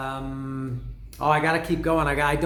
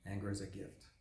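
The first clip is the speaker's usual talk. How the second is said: much softer, lower rapped line; hushed lyric; 6 semitones lower, 16 dB quieter, faster than usual. Pretend. Fan describing the song's quiet part, hushed. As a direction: hold it back before the explosion.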